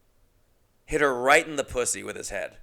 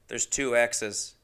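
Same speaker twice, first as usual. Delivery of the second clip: ordinary talk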